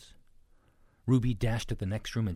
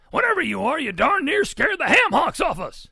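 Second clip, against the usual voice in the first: high, very loud blustering shout; bellowing pig; 14 dB louder than usual. A narrator's reading. Biggest pig tells Ruby she scared them, bellowing. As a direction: bellow it as a startled big hog. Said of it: "bellowed the biggest enormous pig".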